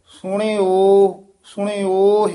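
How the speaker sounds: ਸੁਣੇ ਓਹ with a long, loud final ਓਹ; slow model of ਸੁਣੇ ਓਹ; correct pronunciation shown. Shown as a demonstration. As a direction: stretch the o of ਓਹ after ਸੁਣੇ. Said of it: "ਇਸ ਤਰ੍ਹਾਂ ਸ਼ੁੱਧ ਬੋਲਣਾ ਹੈ".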